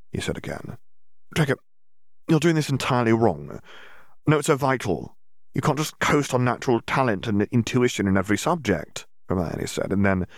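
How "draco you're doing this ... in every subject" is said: put-on setting a boy straight; firm lecturing. A narrator's reading. Hermione lectures Draco.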